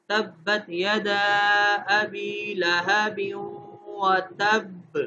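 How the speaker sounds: very slow, drawn-out chanted tilawat; slow tajweed recitation with full qalqalah; Quran recitation. Shown as a demonstration. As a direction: recite Surah Lahab slowly and melodically. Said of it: "ہم سورہ لہب میں اس کی example دیکھتے ہیں".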